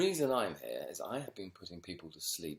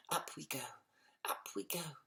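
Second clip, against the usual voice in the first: lightly voiced higher little mole; eager little mutter, higher; muttering to himself; 9 semitones higher than usual. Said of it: "muttering to himself".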